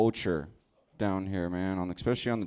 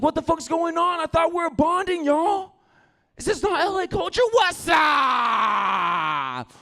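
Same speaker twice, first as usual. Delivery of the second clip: much higher, louder baffled yell; confused mock yell; 7 dB louder than usual. Yelling at the fools, much higher confused mock shout. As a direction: yell it, thrown off.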